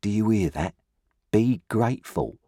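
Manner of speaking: a touch of a stern sister's reproach; low reproachful. A narrator's reading. Sister scolding Pip at the table, mildly reproachful. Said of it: "low, reproachful voice".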